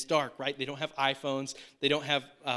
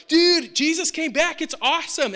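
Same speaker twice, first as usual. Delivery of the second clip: thrilled news; 12 semitones higher, 10 dB louder than usual. Retold or imitated. Disciples telling Thomas Jesus returned, high thrilled gushing. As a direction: burst with the good news.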